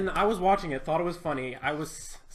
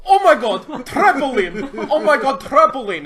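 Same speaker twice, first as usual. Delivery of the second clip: laughing, high, screaming yell; imitating the excited guy from the video; trampoline guy yell; 9 semitones higher, 14 dB louder than usual. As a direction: shriek it out in a laughing frenzy. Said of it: "the guy on the video who just goes".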